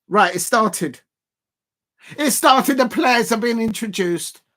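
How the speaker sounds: thrilled the snooker began; excited announcement. Real, not acted. Snooker starting.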